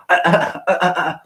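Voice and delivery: high, flustered stammer of uhs; stammering preacher. Retold or imitated. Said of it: "He's like, he went".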